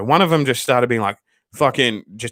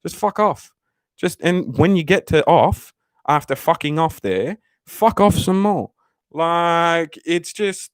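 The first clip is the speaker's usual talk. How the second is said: drawn-out fed-up rant; 7 semitones higher, slower than usual. Real, not acted. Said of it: "ranting at the teens".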